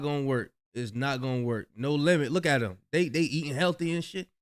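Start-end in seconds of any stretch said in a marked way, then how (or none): none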